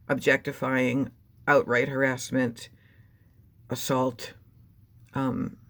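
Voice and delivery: normal talking voice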